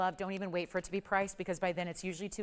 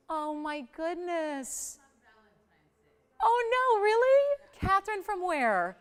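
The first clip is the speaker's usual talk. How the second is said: high, drawn-out, overwhelmed exclamation; gasping high-pitched amazement, swelling louder; real astonishment; 10 semitones higher, 5 dB louder, slower than usual. Real, not acted. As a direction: stretch it out high, then burst louder in disbelief. Delighted reaction to a caller's news.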